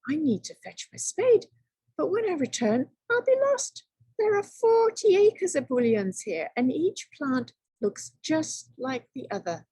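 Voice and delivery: high, rising fretful panic; a worried, rising wail of dismay; dismayed worry. Acted. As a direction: fret about getting lost. Tom dismayed by endless bushes.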